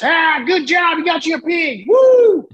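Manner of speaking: high-pitched whooping cheer; imitated celebration. Uncle cheering a boy's first pig, imitated celebration. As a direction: holler congratulations, building to a loud whoop.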